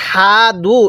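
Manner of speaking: usual speaking voice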